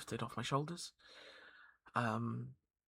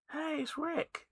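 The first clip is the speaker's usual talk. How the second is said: much higher goofy greeting voice; high silly voice; slower than usual. Retold or imitated. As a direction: squeak it like a cartoon. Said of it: "and he was like".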